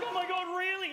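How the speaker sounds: high, loud shocked cry; startled and suddenly scared; panicked gasp. Retold or imitated. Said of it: "She's like".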